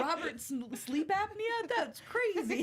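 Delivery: high-pitched incredulous quote, laughing. Retold or imitated. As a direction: pitch it way up, imitating a comic line. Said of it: "He had some funny lines".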